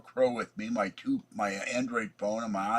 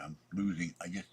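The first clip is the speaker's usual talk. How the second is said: normal talking voice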